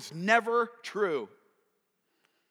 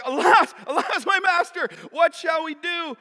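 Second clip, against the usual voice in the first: hammed-up high, loud panic; freaked out; 9 semitones higher, 8 dB louder than usual. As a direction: cry for help as the terrified servant. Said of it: "He's freaked out".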